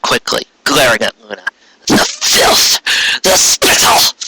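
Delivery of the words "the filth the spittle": much higher, outraged outburst; offended queen's sharp outcry; indignant exclamation. Acted. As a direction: spit out the insult in disgust. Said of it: "she exclaimed".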